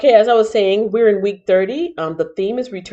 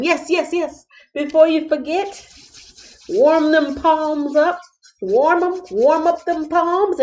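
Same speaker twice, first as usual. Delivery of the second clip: high hyped-up call; hype voice; 9 semitones higher than usual. Acted. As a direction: pump up the crowd, high. Hyping viewers to like.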